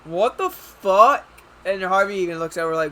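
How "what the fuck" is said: stunned disbelief. Real, not acted. Stunned reaction to the scene.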